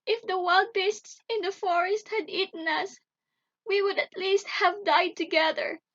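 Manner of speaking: high, loud tearful wail; sobbing. A narrator's reading. Gretel despairs for her brother, high acted sobbing. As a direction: wail it plaintively, high-pitched. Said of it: "she sobbed".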